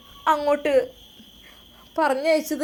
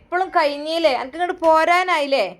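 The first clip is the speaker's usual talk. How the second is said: raised, louder nagging, dragged out; mother scolds son Shamon for staying away, raised nagging voice; scolding mother on phone; 4 semitones higher, 5 dB louder than usual. Acted.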